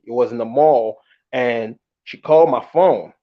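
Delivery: usual speaking voice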